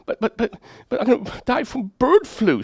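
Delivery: put-on high, stammering little girl voice; scared daughter. Daughter tells her father her fear, high childlike stammer. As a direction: play the frightened child, stuttering. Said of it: "she says".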